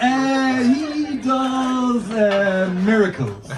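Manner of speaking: high, drawn-out hesitant answer, dropping at the end; halting, strained improvising; awkward stalling. Retold or imitated. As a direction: stretch out each word while groping for an answer. Dad stalling over awkward question.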